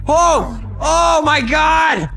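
high, slow shriek of disbelief; shrieking jackpot winner. Real, not acted. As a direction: shriek out the shock. Shock during game chaos.

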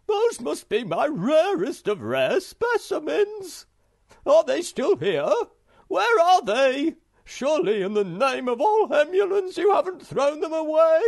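put-on high, loud, alarmed old collector voice; desperate fussy collector fearing the worst; frantic Hemulan. Hemulan frantic about stamps.

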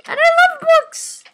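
very high, slow indignant cry; high protest. Pretend. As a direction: shriek the protest. Protesting exaggeratedly.

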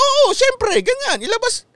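tossed-off high voice, playing the boss; mock president. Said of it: "Sabi niya".

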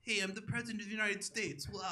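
a throwaway mock Trump; Donald Trump. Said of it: "That's my impression of Donald Trump".

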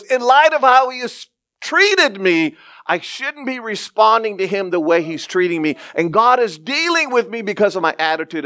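excited retelling. Real, not acted. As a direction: lift the voice, amazed by her insight.

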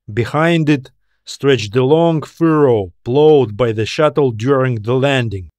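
plain reading voice

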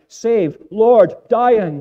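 high, loud cry for rescue; desperate shout. Retold or imitated. Disciples shouting to Jesus in the storm, desperate loud cry. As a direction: scream it like men drowning in a storm. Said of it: "and they shout".

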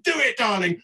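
high, loud, theatrical urging; Francis urging. Acted. Francis spurring on the painters, high and emphatic. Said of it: "And Francis is saying".

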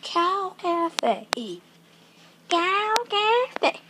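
put-on old Western cowboy twang; light play cowboy drawl. Announcing the cafe in town, old Western cowboy voice. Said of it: "started talking in old Western cowboy voice".

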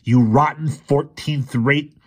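loud, high, slow furious roar; slow high roaring rage. A narrator's reading. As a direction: roar the insult at him. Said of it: "he roared".

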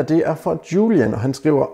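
ordinary delivery

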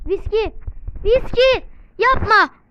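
loud, high panicked yell; screaming the name then a loud don't; yelling at a teammate. Real, not acted. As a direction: shout it out, begging them to stop.

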